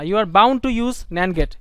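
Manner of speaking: every word laid down slowly; slow, firm emphasis on bound; slow emphatic rule. Shown as a demonstration. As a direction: spell out the rule with weight. Teacher stressing the only rule.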